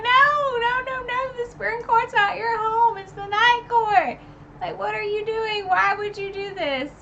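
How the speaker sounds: high, loud frantic protest; upset protest. Retold or imitated. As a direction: plead against it in rising dismay. Her upset outburst at Feyre.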